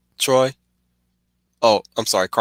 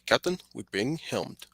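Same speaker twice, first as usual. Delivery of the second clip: Geordi impression; 11 dB quieter than usual. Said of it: "Especially Geordi's voice".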